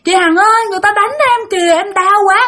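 high, urgent cry to a sister; pained wailing. Retold or imitated. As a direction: cry out high for help, hurting. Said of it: "út của bạn gào lên, la làng lên".

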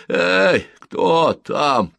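high, loud challenge from a sleeper; annoyed sleeper calling into the night; disgruntled sleepy call. A narrator's reading. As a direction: call out crossly, woken from sleep. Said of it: "донёсся недовольный голос".

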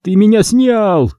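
high, slow cry of dismay; horrified cry. A narrator's reading. Greg accuses Tsypa of photographing him, crying out. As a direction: exclaim it high, dragging the words. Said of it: "вновь закричал Грег, с ужасом".

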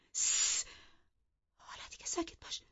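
fast, sharp whispered hush; wife hissing her husband quiet. Acted. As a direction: silence him with a hiss.

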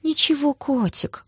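high, slow cooing; soothing a child. A narrator's reading. Sister consoles crying Гоша, cooing gently. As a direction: comfort him like a little boy. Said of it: "гладила его по спине, как маленького".

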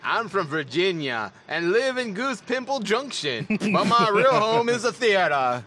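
slow Southern drawl; stretched-out Southern accent; Southern gentleman. Acted. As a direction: play a leisurely Southern gentleman. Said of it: "Southern gentleman".

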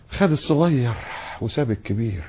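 soft, fading grumble; a hint of resentful complaint; mock bitter complaint. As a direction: mutter the grievance under breath. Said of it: "ويعبر بقى".

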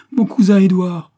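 loud, high cry of discovery; excited exclamation. A narrator's reading. Girl announcing her cousin to father, excited shout. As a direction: call out the news at the top of the voice. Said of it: "s'écria-t-elle".

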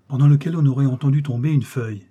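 plain reading voice

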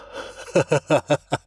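unpleasant cackle; nasty laugh. A narrator's reading. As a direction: laugh nastily, thug style. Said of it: "неприятно рассмеялся".

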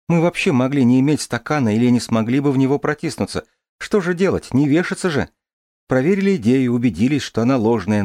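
put-on light, lively girl's voice; young woman voice. Дарья подбадривает. (голос девушки)

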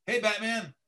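first try, moderately high and bright; Flash audition voice. Acted. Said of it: "can you do a little higher voice?".

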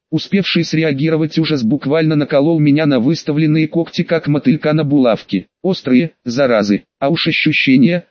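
ordinary delivery, nothing marked

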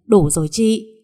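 loud plea to stop. A narrator's reading. Xuân stops the fight.

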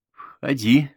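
sing-song invitation, playful. A narrator's reading. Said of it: "сказала Бет игривым голосом". (игриво, с подъёмом)

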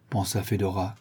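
plain reading voice

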